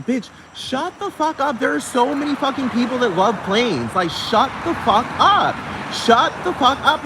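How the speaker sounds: exasperated, high-pitched telling-off; angry retort. Acted. Retorts to imagined Paris Hilton.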